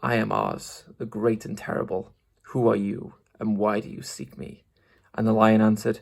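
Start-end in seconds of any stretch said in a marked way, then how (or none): 0.0-2.1 soft, low-key growling voice; softer, hushed growling beast; quiet growl. Acted. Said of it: "the low, quiet growl". 2.5-4.6 soft, low-key growling challenge; softer, hushed growling beast; quiet growl. Acted. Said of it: "the low, quiet growl".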